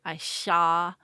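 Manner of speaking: slushy S said as SH in "sha"; "sha" with a wet, slushy SH sound for S; lateral lisp. Shown as a demonstration. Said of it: "it sounds more like an S H".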